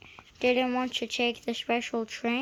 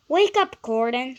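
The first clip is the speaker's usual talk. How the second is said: higher, louder call; waking call; 3 semitones higher, 9 dB louder than usual. A narrator's reading. Driver waking sleepy Gordon, loud call. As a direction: call him awake. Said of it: "said his driver".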